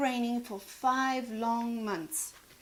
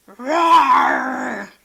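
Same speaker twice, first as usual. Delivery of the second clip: loud roaring; engine roar; 12 dB louder than usual. Acted. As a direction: rumble like an engine. Engine sound.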